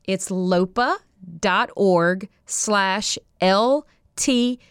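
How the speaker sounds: lopa dot org slash L T C spelled out very slowly. Shown as a demonstration. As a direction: dictate it slowly, one piece at a time. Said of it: "I'll say it slowly for you".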